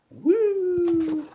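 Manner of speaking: high, loud woo; excited whoop. Real, not acted. Host whooping as the show gets going, high and loud. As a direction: let out a quick excited whoop.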